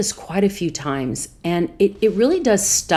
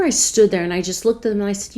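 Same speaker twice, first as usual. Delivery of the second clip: usual speaking voice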